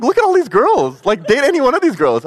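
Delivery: very high, loud insistent plea; pleading brother. Retold or imitated. Brother urging him to date anyone else, very high and loud. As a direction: push the other girls on him, squeaky. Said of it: "I was like".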